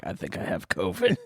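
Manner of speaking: low, soft mock worry; mock whimper. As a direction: mumble it like a sheepish patient. Mocking friend's claim.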